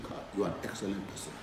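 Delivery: quick, soft praise; appreciative praise. Shown as a demonstration. Showing how to thank someone who helped you, softly and quickly. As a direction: toss out a fast, quiet compliment.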